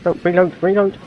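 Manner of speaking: high, urgent repeated reload; frantic urging. Real, not acted. Frantic reload mid-battle.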